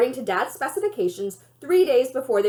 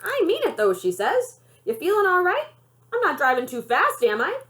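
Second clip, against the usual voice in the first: put-on higher old-lady voice, teasing; grandma voice; 7 semitones higher than usual. A narrator's reading. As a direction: lift it up like the playful grandmother. Jima voiced again.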